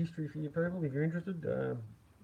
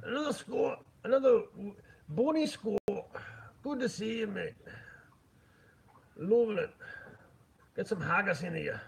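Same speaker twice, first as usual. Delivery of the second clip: put-on playful, higher-pitched Scottish accent; 8 semitones higher than usual. Streamer greeting a viewer from Scotland, butchered Scottish accent. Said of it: "Fucking love the Scottish accent".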